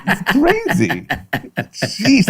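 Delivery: high-pitched, through real laughter; helpless, incredulous laugh in the words. Real, not acted. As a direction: crack up while talking. Cracking up at the idea.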